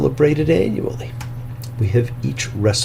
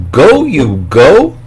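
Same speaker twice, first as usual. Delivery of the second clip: slow, louder, booming command; God's commanding call; 2 semitones higher, 10 dB louder, much slower than usual. Acted. Preacher voicing God's call to Abraham, slow and booming. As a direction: call out like the voice of God, drawing it out. God's emphatic double word calling.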